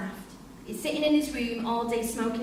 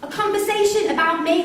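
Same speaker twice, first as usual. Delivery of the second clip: defensive laugh; 6 semitones higher, 6 dB louder than usual. Acted. Wife defensive, half laughing.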